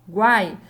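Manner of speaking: loud "Guai" in a single beat; one joined syllable. Shown as a demonstration. Teacher isolating the triphthong, said all together. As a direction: punch "Guai" out as one sound. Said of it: "eu falo tudo junto".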